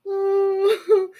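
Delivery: a high, teary oh caught between laugh and cry; real teary oh. Real, not acted. Moved by her reading, she cries out to viewers. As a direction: let it crack up high, half crying. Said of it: "I'm crying".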